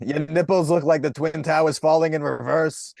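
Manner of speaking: pushed-up impression voice; Rudy Giuliani. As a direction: deliver it as a pompous mock mayor. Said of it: "You can do Rudy".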